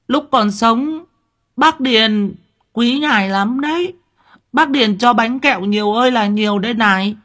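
put-on very high, little-girl character voice; whiny childlike chatter. A narrator's reading. Simple-minded daughter begging father to take her along, whiny chatter. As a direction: pipe up high and insistent like a child. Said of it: "Con Nhài nheo nhéo".

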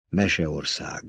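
soft, hushed single word; low-volume storybook murmur; whispering. A narrator's reading. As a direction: breathe it out quietly, as in wonder. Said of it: "suttogta Paul".

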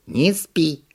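brisk monkey reprimand; stern monkey. A narrator's reading. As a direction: order it sharply as a monkey. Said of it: "строго приказала обезьяна".

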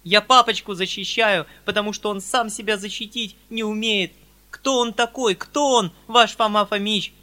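put-on loud, reckless girl's outburst; heated girl raging; carried-away tirade. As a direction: rant in a frenzy of defence. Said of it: "пришедшая в какое-то самозабвение".

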